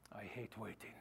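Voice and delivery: put-on low, soft Spanish-accented Inigo Montoya line. Pastor to congregation, Inigo Montoya accent. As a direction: growl it like a vengeful Spanish swordsman. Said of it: "That was in my Indigo Montoya accent".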